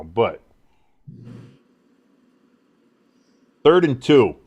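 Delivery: live sports call, rising on the throws; announcer calling the drive; play-by-play. Acted. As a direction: call the game like a broadcaster.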